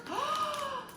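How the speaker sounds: sharp delighted gasp; a high breathy gasp of delight; thrilled little intake of breath. Real, not acted. Unboxing a gift, delighted gasp.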